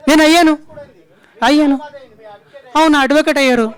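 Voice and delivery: high, loud short bursts; heated insistence. Real, not acted. Actor answers press on appearing before police, loudly insistent.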